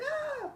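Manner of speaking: a sudden high shriek of fright; very high thin scream; mock shriek. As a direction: squeal like a scared grown man. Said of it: "imagínense los hombres gritando".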